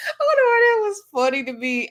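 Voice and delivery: high-pitched, laughing through the words; helpless giggly aside; real laughing. Real, not acted. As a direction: say it while cracking up. Cracks up at retold bros line.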